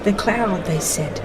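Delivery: usual speaking voice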